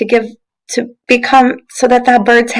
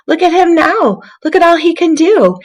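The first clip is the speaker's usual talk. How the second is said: excited cheer; 10 semitones higher than usual. Acted. Twig cheering about Brush.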